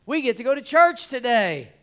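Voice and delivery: high, loud, growing joy; rising excitement. Real, not acted. Preacher urging the congregation to love church, excited. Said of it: "building excitement about church".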